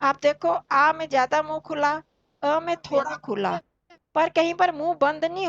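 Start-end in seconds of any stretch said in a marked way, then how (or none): none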